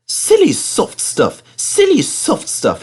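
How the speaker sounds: high, slow scornful puff; puffing engine. Acted. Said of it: "he puffed".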